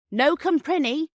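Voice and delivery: a bystander blurting out his only French; sudden. A narrator's reading. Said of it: "said suddenly".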